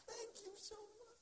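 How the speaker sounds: tiny, high, slow falsetto; mock-tearful gratitude. Rescued man thanking his hosts, high mock-grateful falsetto. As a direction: squeeze out thanks in a drawn-out high voice.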